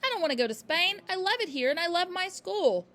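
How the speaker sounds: high loud pleading; a child begging to stay; crying protest. A narrator's reading. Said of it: "Matilda cried".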